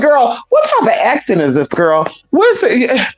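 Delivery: high-pitched, incredulous reaction; mock-baffled, voice jumping up; acted exasperation. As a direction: call her out in disbelief, pitched high. Acts out exasperated reaction to fake accent.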